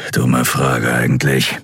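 low, slow grumble; deep, drawn-out self-talk; grumbling to himself. A narrator's reading. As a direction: grumble it to yourself. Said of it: "brummte dann zu sich selbst".

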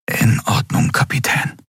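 hushed, whispered assent. A narrator's reading. Miko accepting his captain's order, whispering. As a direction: whisper back obediently to the captain. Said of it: "flüsterte er".

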